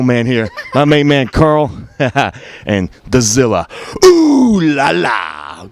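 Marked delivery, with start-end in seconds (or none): 4.0-5.7 slow stretched-out ooh la la; drawn-out catchphrase. Acted. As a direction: savor every syllable slowly. Catchphrase to close promo.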